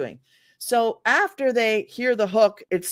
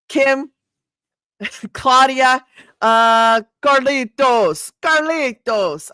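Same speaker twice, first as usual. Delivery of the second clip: put-on a light Spanish accent on Carlitos, slow with a rolled r; 3 semitones higher, 7 dB louder, much slower than usual. Host playfully greeting a viewer named Carlitos in a Spanish accent. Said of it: "I just like to say them with an accent".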